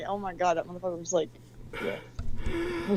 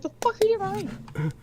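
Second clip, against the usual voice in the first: mock angry; 12 semitones higher than usual. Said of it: "I'm fucking mad".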